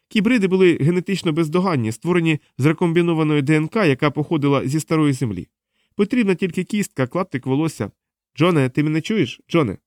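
high-pitched, insistent explainer; interrogating woman. Acted. Diana explains cybrids, then calls to John, insistent.

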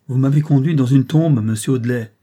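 loud, accusing cry; cried out. A narrator's reading. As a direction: hurl it at him, furious. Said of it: "s'écria-t-elle".

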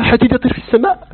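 lightly acted astonished question; mock amazement. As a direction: repeat it as if you can't believe it. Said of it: "هذا تعجب".

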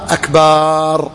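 slow "أكبار" with a long drawn-out aa after the b; lengthened ba. Shown as a demonstration. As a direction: stretch the vowel in "أكبار" into a long aa. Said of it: "لو مد الباء".

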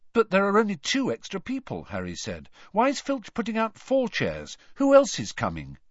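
high, puzzled question. A narrator's reading. Harry asking Ron about the extra chairs, puzzled. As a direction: wonder aloud who else is coming.

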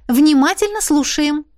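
bright-eyed, high-pitched exclamation; exclaiming. A narrator's reading. Heroine prompts the colonel to start telling, exclaiming. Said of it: "воскликнула".

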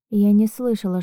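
ordinary delivery, nothing marked

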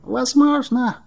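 high-pitched, thin-volumed dragon voice; doubtful water dragon. A narrator's reading. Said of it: "с сомнением в голосе".

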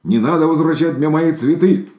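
high, loud, cross outburst; a hurt little bear yelling back; furious and shrill-pitched protest; angry shout. A narrator's reading. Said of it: "сердился медвежонок".

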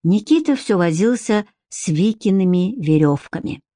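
ordinary delivery

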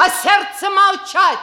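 резко и громко обрывает; приказ замолчать, с силой; властный окрик. Acted. Мать обрывает дочь приказом. (мать обрывает дочь: властный окрик)

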